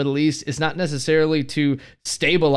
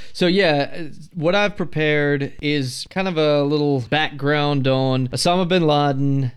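ordinary talk, nothing marked

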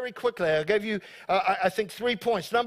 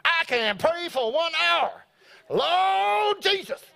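high, showy prayer boast, drawn out at the end; hammed-up, high-pitched mighty pray-er; confident prayer warrior; 6 semitones higher than usual. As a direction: play a believer boasting of long prayers. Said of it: "maybe there are some people here today".

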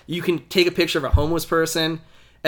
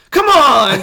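a higher, louder exasperated cry; exasperated yell; 8 semitones higher, 8 dB louder than usual. Retold or imitated. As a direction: throw up your hands and yell it. Batgirl exasperated, calling out.

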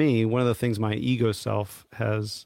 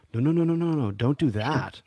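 higher, pleading inner voice saying no; anxious inner voice; 4 semitones higher than usual. Retold or imitated. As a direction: voice your fearful ego stopping you. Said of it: "my ego self is in the background there going".

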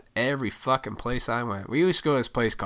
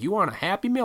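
mom offering; 10 semitones higher, faster than usual. Retold or imitated. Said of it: "If my mom was like".

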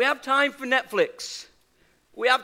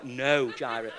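firm no; 9 semitones lower, 6 dB quieter than usual. Retold or imitated. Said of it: "She must have asked me five times".

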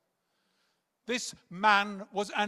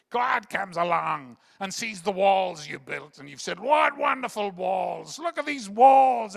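slow, louder, building to a shout; preacher's quote shouted; 12 dB louder than usual. Retold or imitated. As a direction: retell the teacher's line, rising to a mocking shout. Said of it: "and Ern once said".

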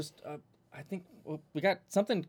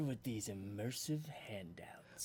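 soft, slow villainous whisper; 7 semitones lower, 11 dB quieter, slower than usual. Acted. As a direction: breathe it like a secret plot. Ad skit, whispered scheme.

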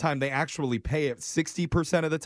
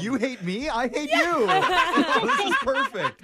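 laughing. Acted. Acting out mutual breakup.

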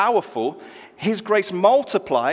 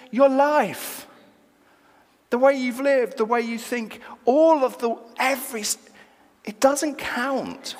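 higher, declaring God's verdict; lightly voiced God; 8 semitones higher than usual. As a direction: pronounce a verdict in a raised pitch, with pauses. God voiced judging a life.

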